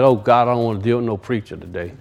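low, soft muttered complaint with a laugh; quiet low aside to himself; grumbling to himself. Retold or imitated. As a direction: grumble under your breath. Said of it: "he said to himself".

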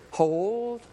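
one long, drawn-out steady word; sustained, intoned restraint; voice of the Spirit. Retold or imitated. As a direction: hold the word out like an inner command. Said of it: "I could hear the Spirit".

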